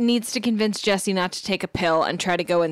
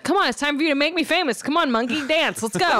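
lightly higher, fast, bossy prodding; pushy manager voice; 7 semitones higher than usual. Retold or imitated. Imitating Zach rousing Jesse to perform, fast and bossy. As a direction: hurry her along like a greedy manager. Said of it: "He's like".

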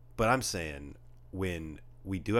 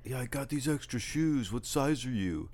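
put-on higher kid voice, casually offering; lightly imitated kid talk; 6 semitones higher than usual. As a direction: do a teenage boy's chatter, pitched up. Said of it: "What did that one kid say?".

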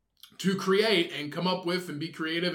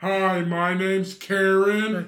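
put-on drunk woman voice; 3 dB louder than usual. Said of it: "Sounds like, uh, what's her name off of".

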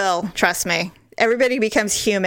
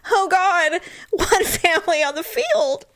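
higher voice breaking into tears; crying; 9 semitones higher than usual. Real, not acted. Mother moved by husband's words, real crying while laughing. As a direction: choke up, half laughing through real tears. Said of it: "Are you crying right now?".

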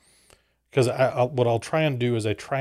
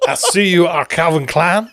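higher, louder hammed-up French accent; mock French accent; 7 semitones higher, 8 dB louder than usual. Cohost riffing on the French actress, hammy higher French accent. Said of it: "outrageous French accent".

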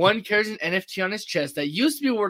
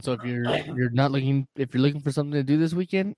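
ordinary talk

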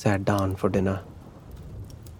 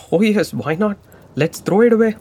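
sarcastic; 9 semitones higher, 5 dB louder than usual. Acted. Son mocks throwing the paw away.

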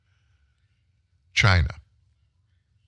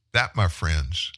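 ordinary talk

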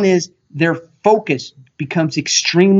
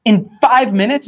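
imagined angry retort; 8 semitones higher than usual. Retold or imitated. Retelling the angry comeback he only thought. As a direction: snap back in mock outrage at the pitch-rusher. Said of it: "And I just got mad".

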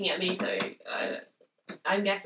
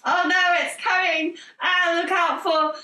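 mock alarm; 8 semitones higher, 3 dB louder than usual. Acting out scary Pringle scene.

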